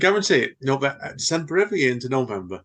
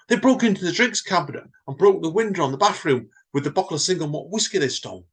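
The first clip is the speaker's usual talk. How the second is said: higher, louder manager listing the damage; exasperated manager; 7 semitones higher, 3 dB louder than usual. Acted. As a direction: rattle off the wreckage in disbelief. [name] continues the damage list.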